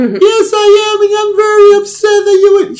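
put-on loud, very high accented falsetto; upset fake girl, thick accent. [name] protests to the doubting caller, upset fake girl voice. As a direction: shriek indignantly as a man faking a girl. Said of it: "She said in a thick accent".

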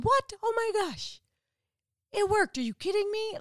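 can't believe it actually worked; astonished disbelief. Retold or imitated. Naaman healed at last, crying out in astonished disbelief.

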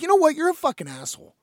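mildly angry impression of a drunk host; mock abrupt insult. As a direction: fire off a retold insult out of nowhere. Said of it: "he just fucking calls me an asshole".

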